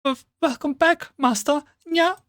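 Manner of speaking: high, shy maid greeting with a nya; squeaky cat girl welcome, stammering. Pretend. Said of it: "the best cat girl voice ever".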